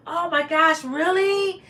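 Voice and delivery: surprised. Real, not acted. Reacting to a viewer's comment.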